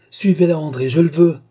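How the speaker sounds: loud, commanding burst, rising in force; forceful command. Acted. Balsamo ordering his seer to follow the fugitive, forceful command. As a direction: order it, allowing no refusal.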